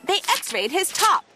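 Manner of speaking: plain reading voice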